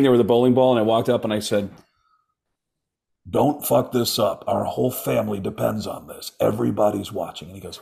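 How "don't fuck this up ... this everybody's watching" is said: [name]. Acted. Comedian poses as Nick's dad at bowling, celebrity impression. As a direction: pose as a stern dad pressuring his son. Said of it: "do your impression for my son".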